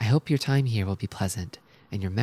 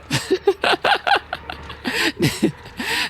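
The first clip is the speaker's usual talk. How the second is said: sudden high laugh; cackling laugh; high burst of laughter; 4 dB louder than usual. Real, not acted. Laughs at stressed-out nurses.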